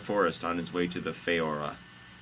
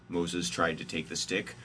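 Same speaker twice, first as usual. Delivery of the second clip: ordinary delivery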